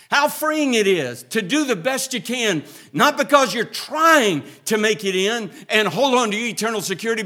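loud, high, exultant cry from the pulpit; joyful full-force preaching. Acted. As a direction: shout the joy of it out to the church. Joyful climax on freedom.

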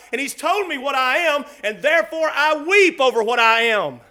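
loud, driving confession from the pulpit; fervent preaching. Real, not acted. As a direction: build in urgent, full-voiced waves. Preaches with full force.

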